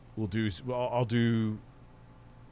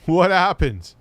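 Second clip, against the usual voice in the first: higher and louder, almost shouting; loud exclaimed question; 8 semitones higher, 8 dB louder, slower than usual. Real, not acted. Streamer shouting at the clip, demanding to know what happened. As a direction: call it out in disbelief.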